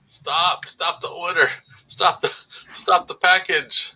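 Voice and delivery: hammed-up urgent shout of stop; mock shout. Imagined order-stop message, hammed.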